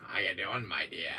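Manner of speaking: usual speaking voice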